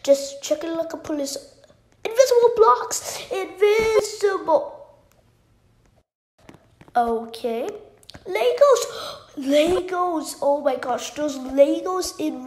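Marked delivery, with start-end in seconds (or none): 2.0-4.8 loud, high excited cry; excited shout. Real, not acted. Spots invisible blocks, excited. 8.3-10.4 loud, high thrilled cry; excited shout. Real, not acted. Spots Legos, thrilled.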